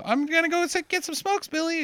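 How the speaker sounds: high casual mom voice; breezy mom leaving. Retold or imitated. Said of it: "mom leaving".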